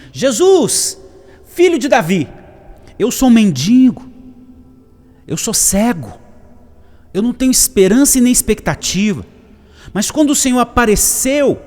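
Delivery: high, full-voiced impassioned cries; shouting beggar. Blind beggar cries out to Jesus, shouting. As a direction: cry out each phrase to be heard. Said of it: "como se ele estivesse gritando assim".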